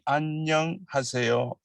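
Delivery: 안녕하세요 split into 안녕, then 하세요; unnaturally broken up. Shown as a demonstration. Teacher showing learners what not to say, 안녕하세요 broken up. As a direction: pull 안녕하세요 apart into careful separate chunks. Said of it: "please not say".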